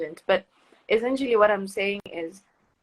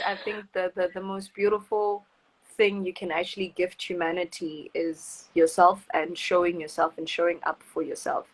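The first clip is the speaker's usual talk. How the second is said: normal talking voice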